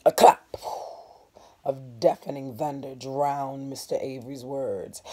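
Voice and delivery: ordinary delivery, nothing marked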